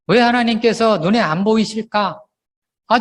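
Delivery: ordinary delivery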